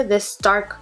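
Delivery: ordinary talk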